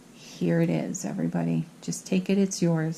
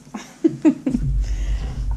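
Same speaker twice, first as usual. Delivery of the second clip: breathy laugh; 8 semitones higher than usual. Real, not acted. Laughs after take it to the bank.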